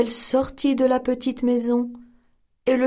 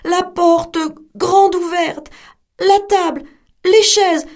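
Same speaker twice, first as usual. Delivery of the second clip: louder, higher alarmed telling; distressed narration; 7 semitones higher, 6 dB louder than usual. A narrator's reading. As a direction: tell the wrecked house with alarm. Mother finds wrecked house.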